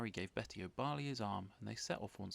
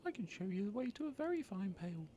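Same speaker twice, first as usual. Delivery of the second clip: much higher tiny snail voice; small snail voice; 10 semitones higher than usual. Acted. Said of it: "said a small squirrely snail".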